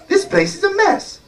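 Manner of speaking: high-pitched exasperated wife; complaining wife. Acted. Giant's wife griping about husband's chores, high exasperation.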